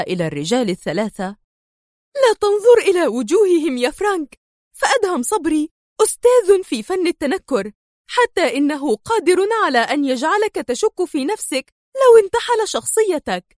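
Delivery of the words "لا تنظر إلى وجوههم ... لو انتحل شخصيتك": high, forceful warning; angry, stern. A narrator's reading. Sonia warning Frank angrily, gun aimed at the men. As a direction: command him sternly, angrily. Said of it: "هتفت سونيا في غضب وصرامة".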